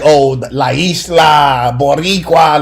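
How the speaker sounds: put-on slow, theatrical Puerto Rican Spanish accent; mock their island pride in drawn-out Spanish; put-on Puerto Rican accent, stretched out. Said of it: "Y'all try to act like".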